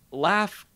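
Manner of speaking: Laugh, tossed up as a joke name; nickname. Shown as a demonstration. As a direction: Laugh said high with a rising tune. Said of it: "I'm gonna call him Laugh".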